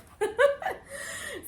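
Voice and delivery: high squealing laugh. Real, not acted. After her prayer story, high squealing laugh. After praying before anesthesia.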